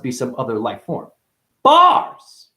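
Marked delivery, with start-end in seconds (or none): 1.6-2.5 high, loud sustained cry; excited shout. Real, not acted. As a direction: belt it out in triumph. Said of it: "Sorry, I'm getting excited".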